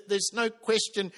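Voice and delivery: usual speaking voice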